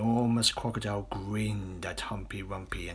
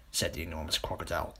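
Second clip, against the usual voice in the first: ordinary delivery, nothing marked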